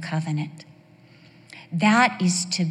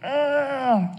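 high, pained "ugh" groan; drawn-out groan of discomfort; 6 semitones higher than usual. Acted. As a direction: groan as if a toe got bumped. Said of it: "you're just like".

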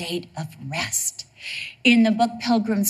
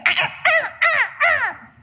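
high mock crow cawing; crow caws; slower than usual. Preacher imitating crows, high cawing again. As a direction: caw like a noisy crow.

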